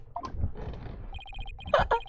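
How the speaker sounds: soft unvoiced snicker; breathy smug laugh.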